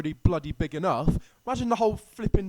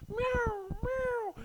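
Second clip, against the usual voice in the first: high meowing cat imitation; high playful meow, meow; 4 dB quieter than usual. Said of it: "cats, they're like".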